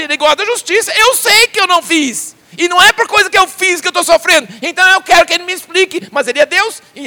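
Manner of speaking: high, loud indignant protest; Job protesting. Retold or imitated. Job defending himself before his accusing friends, loud and high. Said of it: "Ele fala assim".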